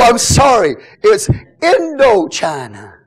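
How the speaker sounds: usual speaking voice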